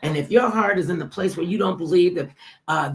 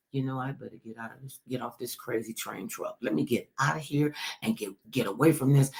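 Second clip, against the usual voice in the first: mock mutter; 5 semitones lower, 6 dB quieter than usual. Voicing aides fleeing in a rant.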